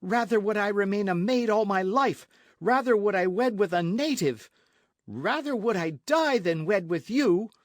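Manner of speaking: high, loud, emphatic tirade; outraged cry. A narrator's reading. Señorita refuses to wed the captain, crying out angrily. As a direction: reject him with mounting scorn. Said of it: "she cried".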